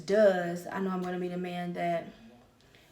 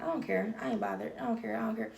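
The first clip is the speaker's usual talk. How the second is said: softer, mock shrugging "I don't care"; fake nonchalance; 6 dB quieter, faster than usual. Mocking people who act unbothered, soft offhand shrug. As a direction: imitate pretending not to be bothered. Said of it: "I act like I'm not bothered".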